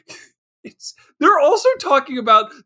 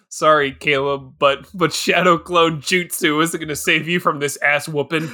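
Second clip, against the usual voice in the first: mock apology; 7 semitones lower than usual. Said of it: "You're like".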